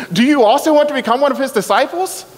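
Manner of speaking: high, mocking question; sarcastic. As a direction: needle them with fake sincerity. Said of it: "I love his sarcasm here".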